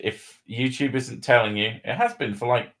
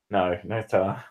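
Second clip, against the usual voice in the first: ordinary delivery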